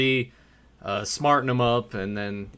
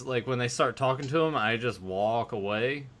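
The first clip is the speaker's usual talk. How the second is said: ordinary talk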